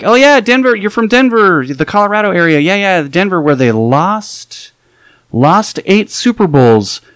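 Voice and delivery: loud, high chatter that slows right down on lost eight Super Bowls; pointed hint. Retold or imitated. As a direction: lean hard on the hint. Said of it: "I'm trying to hint".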